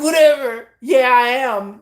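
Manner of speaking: loud, slow mock-crying whine. A Cancer whining at her husband, mock cry. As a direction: whine it like a sulky child.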